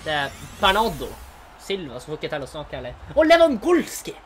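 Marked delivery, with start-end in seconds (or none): 3.1-4.2 high, loud, slow cry; excited shout. Real, not acted. Gamer shouting at the last card reveal.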